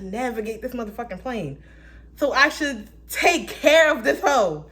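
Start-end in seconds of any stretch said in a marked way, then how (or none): 2.1-4.7 high, loud, slow sassy voice; loud sassy drawl; sassy joke. Acted. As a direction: sass it out, drawn out. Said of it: "joking self-talk".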